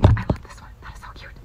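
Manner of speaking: hushed whisper to herself. Real, not acted. Admiring a sticker to herself, whispered. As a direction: breathe it under the breath.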